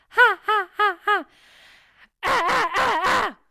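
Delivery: teacher combines grunt, closing and pitch into a scream; high screamed ha. Shown as a demonstration. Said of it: "now we have to add on the pitch".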